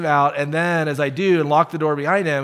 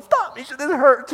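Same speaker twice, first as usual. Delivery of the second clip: high, squealing mock plea; whiny plea; 12 semitones higher, 5 dB louder than usual. Pastor pretending his wife forces Bible reading, squeaky plea. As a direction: whimper like a wife has you in a headlock. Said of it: "and I'm going".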